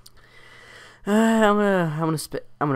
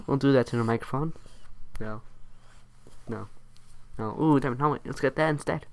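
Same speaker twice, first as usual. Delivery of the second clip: ordinary talk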